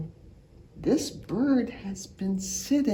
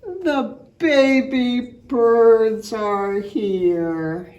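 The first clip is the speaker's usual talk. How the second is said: slow reveal; 7 dB louder, much slower than usual. Acted. Story climax reveal.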